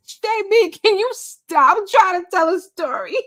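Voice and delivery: high, loud laughing scold; laughing protest. Real, not acted. Telling viewer J B to stop teasing, high and laughing. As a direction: laugh while begging them to stop.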